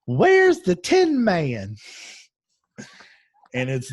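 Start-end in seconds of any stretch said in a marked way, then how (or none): 0.1-1.8 put-on high, loud, drawn-out little-kid voice; little girl's voice. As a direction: pipe up like an eager small child, stretching the words. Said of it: "this little voice goes".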